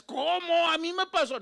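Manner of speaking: hammed-up indignant complaint to God; mock indignation. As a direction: play the offended victim. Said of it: "No le digas".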